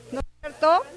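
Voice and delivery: normal talking voice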